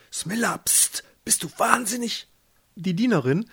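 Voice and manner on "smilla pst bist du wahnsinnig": high, shocked hushing; horrified. A narrator's reading. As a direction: shush the child in alarm, pitch jumping up. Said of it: "Alma war entsetzt". (entsetztes, hohes Zurechtweisen)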